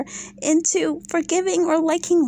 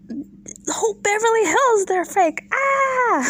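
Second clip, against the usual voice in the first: shouted rant; 9 semitones higher than usual. Real, not acted. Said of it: "escalating rant".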